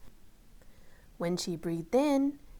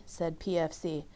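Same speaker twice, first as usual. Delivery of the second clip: ordinary delivery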